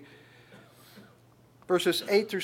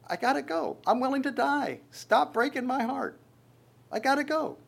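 Paul pleading to go; 8 semitones higher than usual. Retold or imitated. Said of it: "He goes".

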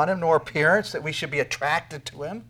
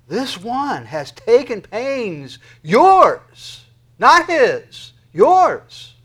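higher and much louder, slow, shouting "yours"; forceful preaching; 9 semitones higher, 14 dB louder, much slower than usual. Real, not acted. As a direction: hammer home "yours, not his, yours". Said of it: "intense, strong, assertive force".

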